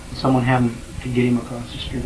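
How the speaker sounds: normal talking voice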